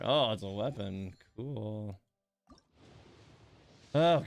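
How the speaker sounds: ordinary talk, nothing marked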